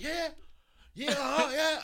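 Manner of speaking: high, loud mock heckle; imitated loud interjections. Big Show without a mic, imitated loud butting-in. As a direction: butt in loudly, playing the big wrestler. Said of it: "'cause he loud".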